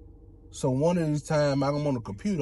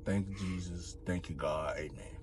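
lower, softer thankful prayer fading out; quiet prayer; 8 semitones lower, 5 dB quieter than usual. Retold or imitated. Him thanking God after the scare, low fading prayer. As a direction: pray it low, trailing into a whisper. Praying in relief.

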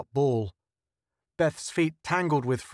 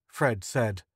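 usual speaking voice

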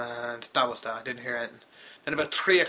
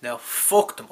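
normal talking voice

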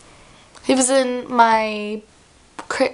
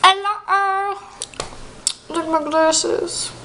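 silly voice; 6 semitones higher, slower than usual. Acted. Silly character voice.

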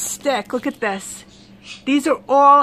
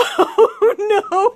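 laughing dismay; 9 semitones higher than usual. Real, not acted. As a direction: cry it out as laughter takes over. Repeats it, now cracking up.

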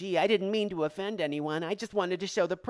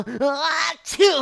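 loud fake sneeze; 9 semitones higher, 7 dB louder than usual. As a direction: sneeze to hide a laugh.

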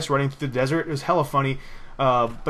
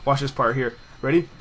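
usual speaking voice

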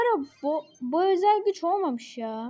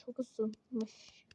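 whisper; 4 semitones lower, 12 dB quieter than usual. Real, not acted. Phone overheating, muttered aside.

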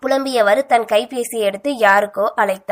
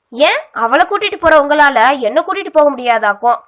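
loud, higher jealous outburst; jealous shout; 5 semitones higher, 6 dB louder than usual. A narrator's reading. Kalaithendral shouting at him at the bus stop, jealous. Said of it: "கத்தினாள் கலைத்தென்றல்".

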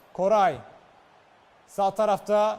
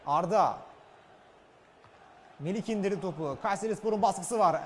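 usual speaking voice

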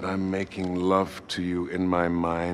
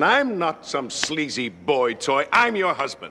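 indignant outburst; 8 semitones higher, 5 dB louder than usual. Acted.

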